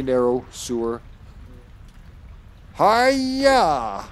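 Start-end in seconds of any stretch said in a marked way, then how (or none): 2.8-4.0 long, high-pitched hiya; drawn-out martial-arts wail; mock karate cry. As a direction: stretch out a play battle cry, high. Retrying the play attack cry.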